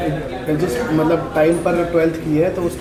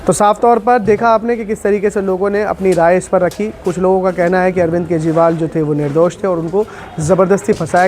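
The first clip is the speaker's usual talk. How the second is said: louder, projected field-reporter voice; news sign-off; 2 semitones higher, 4 dB louder than usual. Real, not acted. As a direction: sum up firmly for the viewers. (समाचार reporter का बुलंद समापन अंदाज़)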